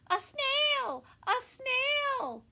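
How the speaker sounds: teacher startled by a snail; alarmed cry. Acted. Teacher spotting the snail, shrieking high.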